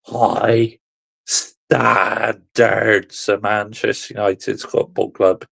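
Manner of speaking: normal talking voice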